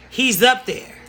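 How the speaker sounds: ordinary talk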